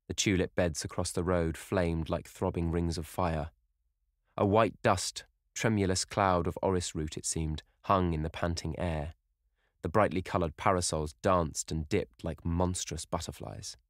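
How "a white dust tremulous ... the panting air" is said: usual speaking voice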